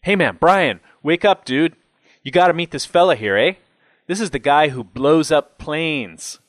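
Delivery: high, loud, eager introduction; excited fan. A narrator's reading. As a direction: rouse a dozing buddy to meet a celebrity. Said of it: "He nudged his companion".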